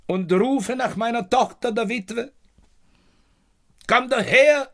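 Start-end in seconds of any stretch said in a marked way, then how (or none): 3.9-4.7 loud, high call to his daughter; father loudly calling his daughter in. Acted. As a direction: call her over across the house. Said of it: "sage ich".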